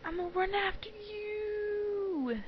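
high, drawn-out teasing call; playful, slow high-pitched pursuit cry; playful chase taunt. Acted. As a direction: stretch the last word out high. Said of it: "And I'm like".

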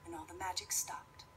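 plain reading voice

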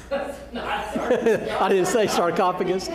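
slow words broken up by real laughter; laughing at himself. Real, not acted. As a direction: laugh through it, amused at your own mistake. Laughs at his own slip.

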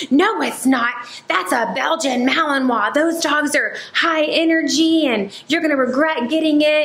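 a hint of a know-it-all lady lecturing; imitating a hater. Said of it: "she's like".

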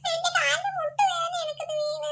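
high, tearful whimper; child crying over a hurt knee; crying complaint. Acted. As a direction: sob out that the knee hurts.

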